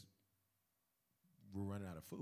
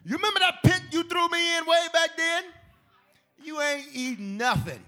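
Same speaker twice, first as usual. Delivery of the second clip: loud, high mock-indignant Joseph; big, high-pitched comic confrontation; taunting Joseph; 9 semitones higher, 8 dB louder than usual. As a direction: crow it out at the brother who wronged you. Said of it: "Joseph has an opportunity to say".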